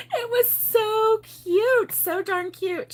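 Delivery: high, slow gushing over a cute grandchild. Real, not acted. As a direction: melt over how cute it was.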